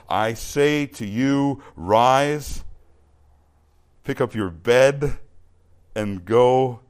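loud, slow, word-by-word command; solemn command. Acted. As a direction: pronounce it with authority, pausing between phrases. Reads Jesus' command to paralytic.

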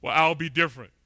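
loud mock cockiness; acted self-assured brush-off; arrogant. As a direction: boast it loudly, imitating the overconfident. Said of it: "this sense of arrogance".

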